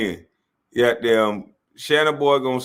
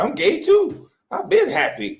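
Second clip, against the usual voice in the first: high, squeaky Chad mimicry; mock Chad. Chad bragging on air, mocked in a high voice. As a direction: pitch way up into a silly falsetto. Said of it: "Chad talking about he ad-libbing".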